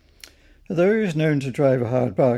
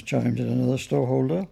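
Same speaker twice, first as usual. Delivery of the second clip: ordinary delivery, nothing marked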